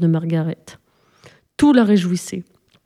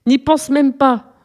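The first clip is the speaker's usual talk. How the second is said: slow, higher, faltering voice; balbutiement; 5 semitones higher, slower than usual. A narrator's reading. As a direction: force it out slowly, just coming round. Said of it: "balbutia Simon". (balbutiement lent, voix plus aiguë)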